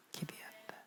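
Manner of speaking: 기대한다 breathed in a whisper; whispered echo of 기대한다 for emphasis. Shown as a demonstration. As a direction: repeat 기대한다 under the breath, voiceless. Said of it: "셋째는 기대한다".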